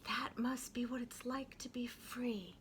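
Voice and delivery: soft whispered wish; whispered thought. A narrator's reading. Young Mary whispering her longing for freedom. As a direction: breathe it like a secret daydream. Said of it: "she thought".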